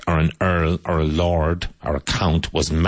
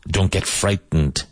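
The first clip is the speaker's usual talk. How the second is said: ordinary talk, nothing marked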